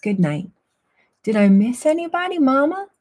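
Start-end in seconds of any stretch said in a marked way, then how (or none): 1.2-2.9 put-on high, quick child-like question; high-pitched and eager, rising at the end; little pup asking. A narrator's reading. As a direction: ask Mama in a small, high pup voice. Little otter asks Mama, story dialogue.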